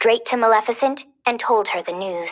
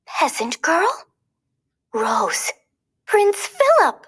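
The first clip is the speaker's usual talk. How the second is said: it dawns on her all at once; disbelief; 5 semitones higher than usual. A narrator's reading. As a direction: gasp then cry out the name. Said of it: "Flora couldn't believe it".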